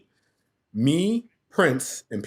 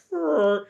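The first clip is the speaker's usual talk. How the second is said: high rolled horn toot; imitated horn noise; 13 semitones higher than usual. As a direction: mimic a single horn sputtering high. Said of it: "and it's like".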